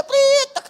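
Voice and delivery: shrill-pitched, loud "prit"; imitated parking whistle. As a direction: mimic an attendant's sharp whistle signal. Imitating parking attendant's whistle signal. (tiruan bunyi peluit tukang parkir)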